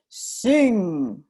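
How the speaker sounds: loud curt giant command; giant's order. A narrator's reading. Giant ordering the harp, loud and curt.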